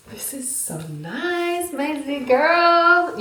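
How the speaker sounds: high, slow cooing over the bag; cooing delight. Real, not acted. Cooing at the new bag, high and slow. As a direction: swoon over it like a gift.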